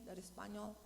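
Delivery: ordinary talk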